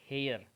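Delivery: हेयर said as air, the h dropped; silent h in heir. Shown as a demonstration. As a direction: say हेयर like एयर, no h sound. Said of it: "इसका pronunciation दोस्तों होता है".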